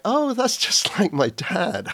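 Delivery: re-enacted penny-drop moment; amused realisation. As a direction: laugh as it dawns on you. Acting out a sudden realisation.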